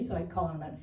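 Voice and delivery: ordinary talk, nothing marked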